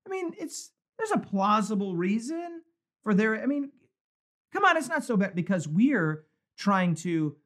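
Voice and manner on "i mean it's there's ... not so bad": mock someone brushing off a sin; excusing whine. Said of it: "It's like".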